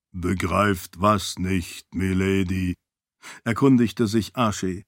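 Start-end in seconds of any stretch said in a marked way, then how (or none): 0.1-2.7 lower, slow, calm attendant; low servant voice. Acted. Servant asks his lady, low calm voice. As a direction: ask it low and measured.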